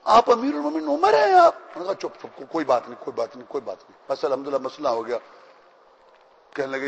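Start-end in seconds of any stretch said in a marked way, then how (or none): 0.0-1.5 high, loud gasp of a question; stunned to learn who he is; startled disbelief. Acted. Bedouin realizes the caliph helped him, asking in high startled disbelief. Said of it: "تو وہ پریشان". 2.1-5.2 soft, low hurried hush. Acted. Caliph hushes the grateful bedouin, quiet and quick.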